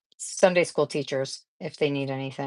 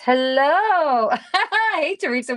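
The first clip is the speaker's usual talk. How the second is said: excited greeting; 10 semitones higher, 4 dB louder, slower than usual. Real, not acted. Greeting the cat Teresa.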